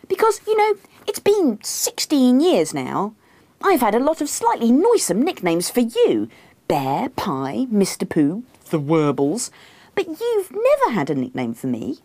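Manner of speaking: high, wheedling complaint; pointed, high-pitched bedtime nagging; needling wife. A narrator's reading. Acts herself complaining.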